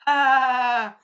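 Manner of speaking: high, loud imitated scream; drawn-out cry of someone burning; mock scream. Retelling the burned villain, high mock scream. Acting Bitch being burned.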